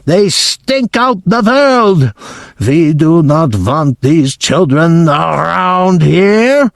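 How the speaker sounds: a loathing witch ruler; Grand High Witch. Acted. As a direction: sneer it out slowly and high. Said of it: "she screamed".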